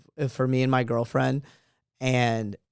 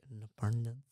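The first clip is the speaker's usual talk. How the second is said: put-on softly echoed Indian accent; 2 semitones lower, 14 dB quieter than usual. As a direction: murmur it to yourself in an Indian accent. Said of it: "in my head".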